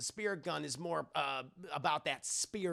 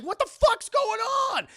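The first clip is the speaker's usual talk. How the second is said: loud, high-pitched panicked shout; acted panic, voice shooting way up; panicking; 10 dB louder than usual. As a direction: shriek it in hammed-up alarm. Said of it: "he starts panicking".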